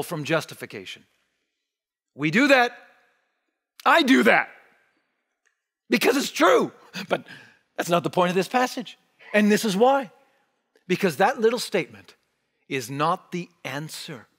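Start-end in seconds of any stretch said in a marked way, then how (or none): none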